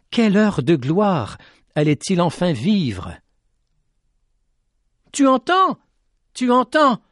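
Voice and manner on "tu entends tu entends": put-on high, eager boy calling; eager repeated question. A narrator's reading. Boy urging the lady to listen, high and eager. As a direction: excitedly urge her to listen. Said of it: "répétait-il à la dame de la montagne".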